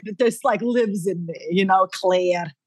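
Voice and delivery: put-on drawn-out Scottish accent on "Claire". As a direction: stretch "Claire" like a Scot. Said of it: "I have a bit of it".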